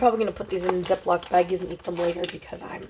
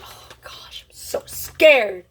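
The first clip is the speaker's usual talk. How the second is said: scared whisper; 4 dB louder, much slower than usual. Real, not acted. Nervous before tasting sour candy.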